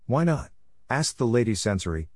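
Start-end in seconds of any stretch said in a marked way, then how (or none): 0.1-0.5 abstract curiosity. Acted. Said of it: "in a tone of purely abstract curiosity".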